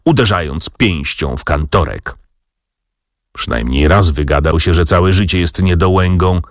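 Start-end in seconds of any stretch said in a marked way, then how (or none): none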